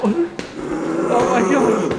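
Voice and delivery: slow, high, drawn-out zombie moan. Acted. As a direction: drag it out like a zombie. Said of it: "There's my zombie impression".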